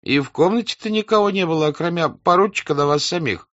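high, insistent servant voice; the servant insisting nobody else was in the room, high and plaintive; servant Lavrushka. Acted. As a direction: plead it up high. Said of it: "сказал Лаврушка".

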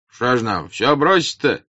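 protesting peasant. Acted. Peasants refuse to abandon everything, protesting. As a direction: object loudly from the crowd. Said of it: "раздалось в толпе с разных сторон".

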